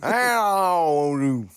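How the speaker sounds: very slow slurred cowboy greeting; mock drunk cowboy. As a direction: drag it out like a tipsy cowboy. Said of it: "kinda drunk cowboy".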